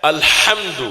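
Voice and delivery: الحمد with a plain h, əl-hamd; common mispronunciation. Shown as a demonstration. Preacher imitates common people's الحمد, plain h. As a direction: mimic the careless الحمد with a light h. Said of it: "عام لوگ کیا پڑھتے ہیں؟".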